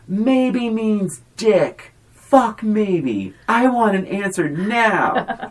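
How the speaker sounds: high, drawn-out imitation of an angry fiancé; mock angry demand. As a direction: act out an indignant ultimatum, stretching the words.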